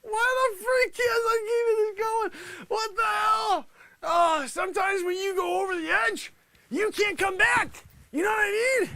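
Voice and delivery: high, tearful outburst; losing composure. Real, not acted. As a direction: wail it on the edge of hysterics. Bursts out again after calming down.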